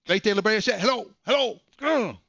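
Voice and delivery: high, loud hellos ending in a groan; confused, then fed up; baffled hellos. Retold or imitated. Nobody on the line again.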